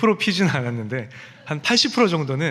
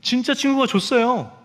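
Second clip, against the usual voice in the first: put-on insistent child; 8 semitones higher than usual. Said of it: "그랬더니".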